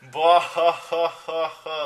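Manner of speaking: hammed-up evil laugh; villain laugh. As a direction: cackle like a gloating villain. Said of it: "laughed Voldemort".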